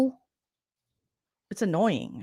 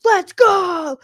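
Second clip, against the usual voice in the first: higher, louder hype shout; hyped competitor; 10 semitones higher, 7 dB louder than usual. Retold or imitated. Host mimicking Morgan's pumped-up contest cheer. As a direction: pump yourself up like a jock. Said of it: "she was like".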